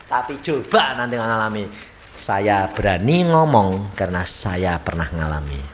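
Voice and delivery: ordinary talk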